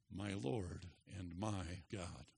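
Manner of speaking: soft, low, slow confession; hushed reverence. Acted. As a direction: say it slowly in quiet awe. Voices Thomas's awed response to Christ.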